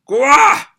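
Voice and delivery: loud, high strained yell; acted cry of effort in a fight; battle cry. Fighter straining in battle, crying out.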